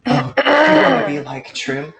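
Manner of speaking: taken aback, pitched well up and stretched out; surprised. Acted. Doctor reacting to patient's wish to be like Trim, surprised.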